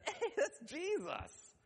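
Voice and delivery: high, drawn-out imitated excitement; John. John spots Jesus from the boat, thrilled.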